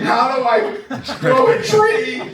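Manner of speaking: high, slow, puzzled mock whine; laughing mock confusion; mock bewildered. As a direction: play the baffled beginner, stretched out. Mocking a puzzled seed-holder.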